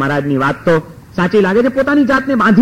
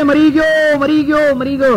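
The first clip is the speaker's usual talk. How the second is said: higher pitched mock yell of dying; mock cries of pain; 6 semitones higher than usual. Shown as a demonstration. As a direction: show how to fake crying out in pain. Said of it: "જોર જોરથી કે મારા પેટમાં દુખે છે". (ઊંચા સૂરે નાટકીય બૂમો)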